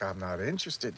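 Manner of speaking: low, soft acted brush-off; uninterested refusal. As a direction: play a quiet, unbothered no.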